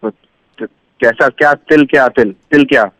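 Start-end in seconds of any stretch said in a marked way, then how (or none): none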